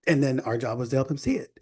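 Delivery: ordinary talk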